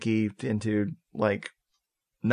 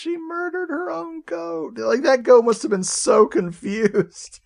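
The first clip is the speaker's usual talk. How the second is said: much higher, wailing over the poor goat; anguished squeal; 14 semitones higher than usual. Real, not acted. Telling co-host about the goat, high anguished wail. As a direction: cry out in pained disbelief.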